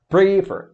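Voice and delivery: Prefer punched high and loud on PRE; wrong first-syllable stress. Shown as a demonstration. Teacher repeating the mistake for students, Prefer stressed on PRE. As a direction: hit PRE hard in Prefer, drop the second syllable. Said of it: "e não prefer".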